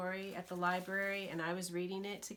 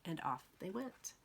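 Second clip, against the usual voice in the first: plain reading voice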